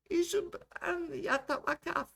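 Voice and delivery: very high, slow rant; ranting older woman. Acted. Older woman rants at the camera, high and drawn out. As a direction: pitch it way up as an impassioned old woman. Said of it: "the older woman continued to rant".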